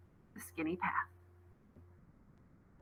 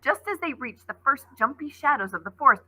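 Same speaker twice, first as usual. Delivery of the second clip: plain reading voice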